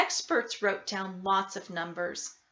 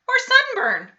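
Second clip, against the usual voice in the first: high, loud shrill-free squeak of a doctor; squeaky doctor voice; 10 dB louder than usual. A narrator's reading. Doctor Young chimes in to the Creams, high and loud.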